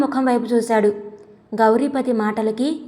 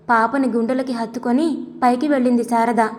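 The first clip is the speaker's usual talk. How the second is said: ordinary delivery, nothing marked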